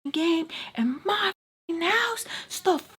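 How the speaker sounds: high, hissed furious rant; pissed. Retold or imitated. Tommy going off at them in her house, high hissed fury. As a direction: seethe it through your teeth. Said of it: "Pissed, right?".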